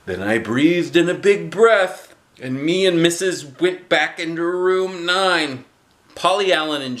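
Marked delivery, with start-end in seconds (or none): none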